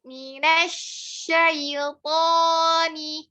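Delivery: Minasyaitoni drawn out slowly, mouth open; slow tajwid recitation. Shown as a demonstration. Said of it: "Buka lagi mulutnya".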